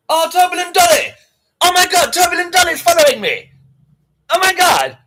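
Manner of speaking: loud high squeal of excitement; thrilled, shouting; excited shout. Real, not acted. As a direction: shriek with delight at the new follower. Excited new follower, shouting.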